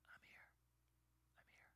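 barely audible reassuring whisper; God's whisper. Acted. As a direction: whisper it close, as God to Elijah. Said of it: "he's there whispering".

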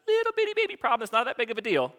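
put-on high, hurried little baby-talk voice; mock minimizing. Preacher mimicking someone excusing a problem, mock minimizing. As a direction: belittle it in a small, high-pitched voice.

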